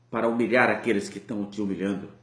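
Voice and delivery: ordinary delivery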